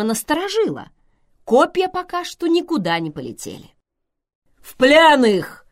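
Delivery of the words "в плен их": slow loud order to seize them; commanding shout. Acted. Zeus orders guards to seize them, slow loud command. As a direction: boom the order to take prisoners. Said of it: "сказал Зевс".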